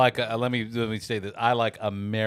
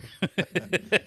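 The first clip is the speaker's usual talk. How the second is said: laugh; 10 semitones higher than usual. Real, not acted. Laughs after caw-caw bit.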